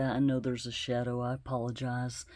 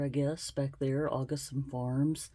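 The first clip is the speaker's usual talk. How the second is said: ordinary talk, nothing marked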